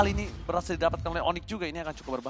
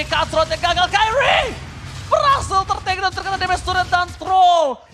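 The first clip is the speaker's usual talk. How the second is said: higher, louder excited play-by-play; esports caster; 9 semitones higher, 6 dB louder than usual. Real, not acted. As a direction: shout the teamfight call live. Live play-by-play calling.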